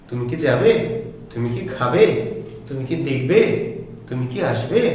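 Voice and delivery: quick short "কি", pitch rising on the final verb; yes-or-no question with a brief, unstressed "কি". Shown as a demonstration. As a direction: pass lightly over "কি", lift the last word. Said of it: "ছোট করে দ্রুত উচ্চারণ করতে হবে".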